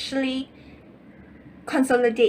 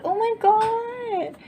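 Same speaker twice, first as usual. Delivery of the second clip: high, slow, crying-out amazement; amazed gasp; 11 semitones higher, much slower than usual. Real, not acted. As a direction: gasp with delight. Gasps at the World card.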